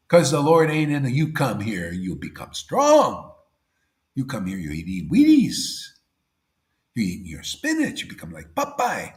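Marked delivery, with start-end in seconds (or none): none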